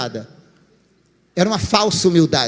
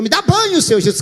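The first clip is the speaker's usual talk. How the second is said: eager plea; 8 semitones higher than usual. Acted. Peter leaping into the basin.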